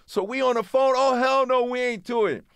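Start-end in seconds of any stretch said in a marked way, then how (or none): 0.9-2.4 high-pitched mock protest; indignant refusal. Rappers on the phone refusing, indignant. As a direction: holler the refusal down the line.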